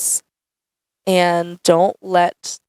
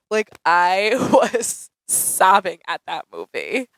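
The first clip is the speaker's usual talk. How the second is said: laughing; slower than usual. Real, not acted. Laughing while retelling sobbing.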